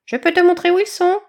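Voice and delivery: high, loud hopping chick voice; bouncy bird chick. Acted. Chick eagerly offering to show badger the way, high and loud. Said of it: "sautilla le Raffaello".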